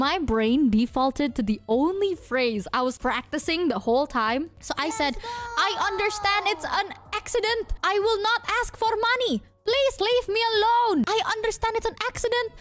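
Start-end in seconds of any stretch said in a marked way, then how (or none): 5.7-12.5 high, frantic mock pleading; re-enacted tearful wail, pitched way up; about to cry. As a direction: repeat the plea in rising hammed-up panic. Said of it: "I was, like, about to cry".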